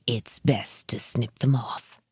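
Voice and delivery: low, soft, slow menace; understated cold villain; soft sinister threat. A narrator's reading. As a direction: purr the threat calmly.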